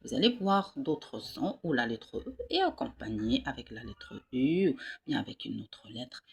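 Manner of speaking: ordinary delivery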